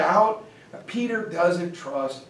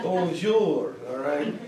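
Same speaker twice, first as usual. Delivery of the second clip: mock French greeting; a put-on French hello; weird French accent; 3 dB quieter, slower than usual. Disguised tsar greets people abroad, weird French accent. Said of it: "with a weird French accent".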